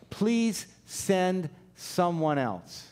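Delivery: very slow, spaced-out acted appeal; slow plea. Moses begs the Lord to send another, slow deliberate plea. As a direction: plead one word at a time. Acting Moses pleading to be excused.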